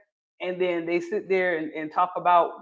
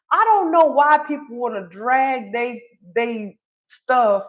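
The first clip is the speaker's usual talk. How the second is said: higher, louder Southern drawl put on on purpose; Southern accent; 5 semitones higher, 4 dB louder than usual. Shown as a demonstration. Vlogger voicing her "Southern mind" before cleaning it up, Southern accent. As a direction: lean into a broad Southern accent, half joking. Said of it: "I'ma say it in my Southern mind".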